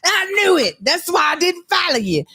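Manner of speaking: high, mock-indignant follower; imagined indignant follower. Voicing imagined follower's outrage.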